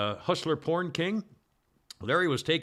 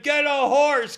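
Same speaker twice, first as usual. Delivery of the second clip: yelling; 9 semitones higher, 7 dB louder, slower than usual. Retold or imitated. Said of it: "Somebody said".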